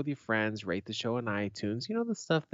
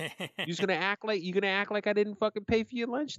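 squeaky, much higher taunting voice; mock him in a high whiny pitch; 10 semitones higher than usual. Friend mocking a buddy who skipped paying for lunch, high squeaky taunt.

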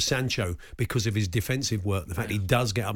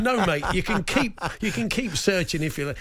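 laughing; 7 semitones higher than usual. Real, not acted. Retelling his own reply, laughing.

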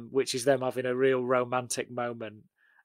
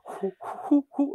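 high airy pipe hoots; wooden pipe imitation; 12 semitones higher than usual. Shown as a demonstration. Podcaster mimicking the film's pipe music, hooting notes. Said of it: "like, wooden pipes".

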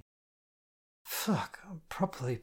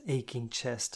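plain reading voice

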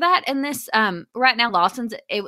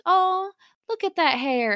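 high-pitched cooing stranger; admiring passerby; 9 semitones higher than usual. Retold or imitated. Mother quotes a woman admiring her son's hair, high coo. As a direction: imitate a woman gushing over hair. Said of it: "This woman walked past and goes".

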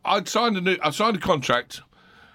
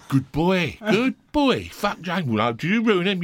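higher, mock-proud London geezers praising; chuffed hard men patting a good boy; hard men cheering; 7 semitones higher, slower than usual. Pub hard men praise Razor, mock-proud. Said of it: "the hardest men in London would go".

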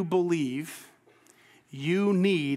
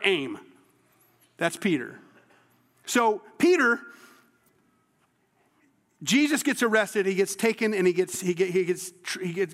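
ordinary delivery

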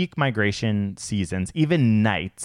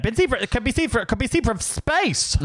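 much higher, sing-song mocking of a cliché; mock headline voice; 12 semitones higher than usual. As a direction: lift it way up to mock a breathless headline. Mocking science journalism cliché.

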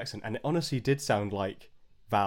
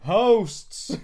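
higher and louder, a sudden mock cry; mock exclaim; 10 semitones higher, 7 dB louder than usual. Real, not acted. Friend echoing the word, louder and higher. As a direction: blurt it out as an outraged joke.

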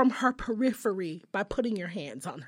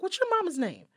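higher-pitched, pointed question; insistent repeat; 8 semitones higher than usual. Acted. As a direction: repeat it to someone who missed it. Repeats question after his Huh.

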